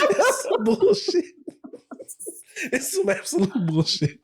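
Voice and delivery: high, laughing through the complaint; helpless laughter. Real, not acted. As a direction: squeeze the words out between real laughs. Laughing helplessly over losing player.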